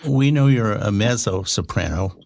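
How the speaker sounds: ordinary delivery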